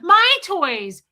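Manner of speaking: put-on loud, high child's protest; selfish kid. Kid refusing to share toys, imitated loud protest. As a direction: imitate a possessive kid crying out. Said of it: "kids are like".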